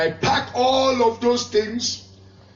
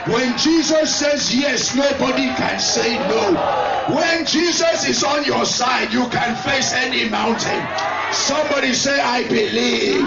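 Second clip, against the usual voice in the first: loud rising pulpit declarations over music; full-force preaching; 5 semitones higher, 5 dB louder than usual. Acted. Preacher rousing the congregation with a loud preaching voice. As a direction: preach it out, building to the call.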